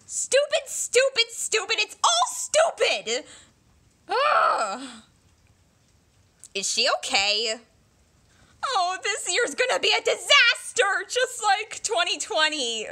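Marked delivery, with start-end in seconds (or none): 0.1-4.8 higher, repeated angry stupid; frustrated outburst. Acted. 8.7-12.9 higher, teary lament; whiny crying. Acted. Despair over the year.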